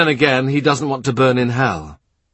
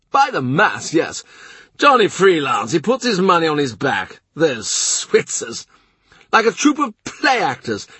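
the blustering Duke of Norfolk, pitched higher; gruff duke; 8 semitones higher than usual. A narrator's reading. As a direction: play the proud, impatient duke. Duke scorns Swiss soldiers.

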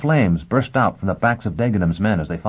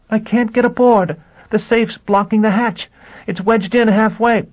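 panicked; 5 dB louder than usual. Acted. Gisbella panicking on radio.

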